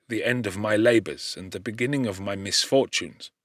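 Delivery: ordinary delivery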